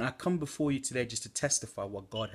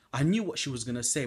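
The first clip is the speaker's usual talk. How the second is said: normal talking voice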